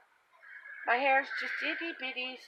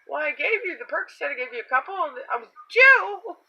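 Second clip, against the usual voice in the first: incredulous; 7 semitones higher, 5 dB louder than usual. Retold or imitated. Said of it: "'cause I had asked".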